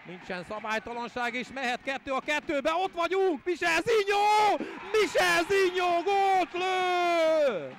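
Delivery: loud high goal scream; excited Hungarian sports commentary. Acted.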